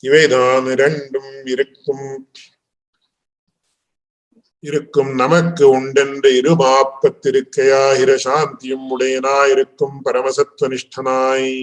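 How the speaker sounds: rapid practised chant of the Tamil text; scripture recitation. Acted. Teacher recites the commentator's Tamil words to students, rapid chant. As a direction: rattle off the verse from memory.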